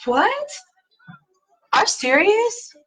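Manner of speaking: high, incredulous what, are you serious; mock shock at the grandkids; squeaky outraged disbelief. Reacting to pampered grandkids.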